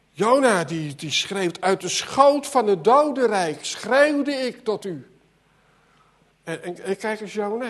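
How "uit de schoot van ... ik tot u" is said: crying out. Acted. Jonah crying out from the deep.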